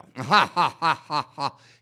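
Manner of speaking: loud burst of laughter; big open ha-ha-ha laugh. Real, not acted. Laughing at own impression.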